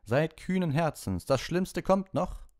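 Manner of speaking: high, loud, slow heroic knight voice; knight calling. Acted. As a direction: spur them on up the stairs like a knight. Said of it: "rief der Hitter".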